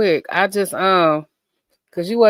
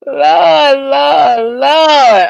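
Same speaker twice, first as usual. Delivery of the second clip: louder, higher pleading Lord cry; Messy Michon; 6 semitones higher, 6 dB louder, much slower than usual. Retold or imitated. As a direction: wail it out to the Lord. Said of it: "what's that Messy Michon say?".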